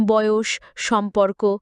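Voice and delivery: plain reading voice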